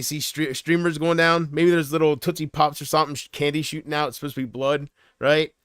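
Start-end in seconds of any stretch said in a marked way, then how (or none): none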